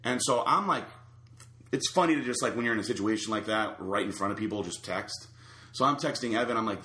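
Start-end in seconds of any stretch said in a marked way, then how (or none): none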